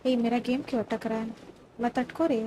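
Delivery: ordinary talk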